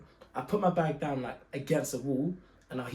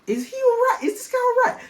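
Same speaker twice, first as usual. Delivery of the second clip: much higher and louder, mock-stunned; incredulous; 6 dB louder than usual. [name] recalling his shock at the officer sniffing the bag, incredulous. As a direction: squeak it out like you can't believe it. Inner thought at weird officer.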